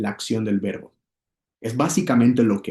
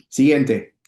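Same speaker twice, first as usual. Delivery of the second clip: usual speaking voice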